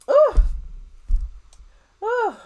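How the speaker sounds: high teasing ooh; a scandalised high ooh; surprised ooh. Real, not acted. Reacting to caller's gossip.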